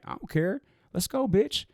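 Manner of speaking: high teasing dare, tossed off lightly; playful dare. Retold or imitated. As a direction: egg him on, half joking. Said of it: "But it was like that".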